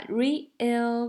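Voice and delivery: "real" broken into re-al; split syllables. Shown as a demonstration. Teacher showing students, "real" in two syllables. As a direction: stretch "real" over two beats. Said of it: "把它拆开".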